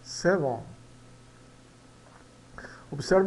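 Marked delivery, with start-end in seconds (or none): 0.0-0.6 "seven" with the second E near an O, "sevon"; teacher modelling "seven"; pronunciation demo. Shown as a demonstration. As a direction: end "seven" on an o sound, "sev-on". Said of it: "ele soa como um O".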